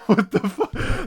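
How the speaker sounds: loud, laughing what the fuck; laughing disbelief. Retold or imitated. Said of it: "I was like".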